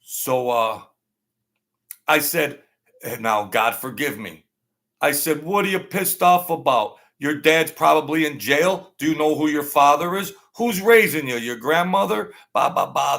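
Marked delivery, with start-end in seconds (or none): 5.4-12.3 angry retort. Retold or imitated. Teacher confronting angry student.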